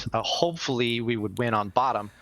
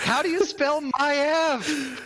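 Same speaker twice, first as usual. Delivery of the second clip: drawn-out high-pitched mock scream; screaming out a desperate question, hammed up; retold panicked scream; 3 dB louder, much slower than usual. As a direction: yell it in a high, stretched-out panic. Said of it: "I was screaming".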